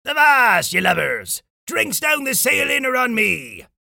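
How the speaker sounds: hearty pirate bellow, high and loud; Captain Fishbreath. Acted. Captain Fishbreath treats his crew to drinks, loud bellow. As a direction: bellow it out across the deck. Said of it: "turned to his crew and bellowed".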